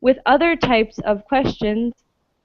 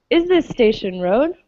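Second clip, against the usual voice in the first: "Is this Station Road?" with the voice climbing at the end; teacher models rising intonation for students; rising question tune. Shown as a demonstration. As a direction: lift each yes-no question upward at the close. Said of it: "repeat after me".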